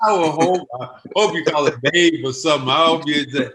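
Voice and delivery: ordinary talk, nothing marked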